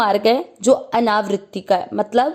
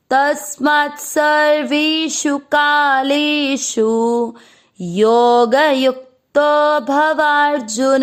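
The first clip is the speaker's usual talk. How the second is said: Shri in नैते स्मृति पार्थ जानन sung out slowly, a touch higher; slow verse recitation; 4 semitones higher, much slower than usual. Shown as a demonstration. Teacher reciting the Gita line slowly for students to follow. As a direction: chant each syllable of the verse slowly and evenly. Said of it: "तीसरा और चौथा चरण साथ में".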